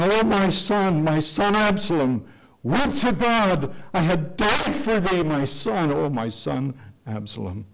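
high, loud, slow anguished wail for a lost son; wailing lament. Retold or imitated. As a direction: cry it out to God like a grieving father. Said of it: "he's wailing, and he's weeping".

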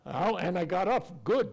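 normal talking voice

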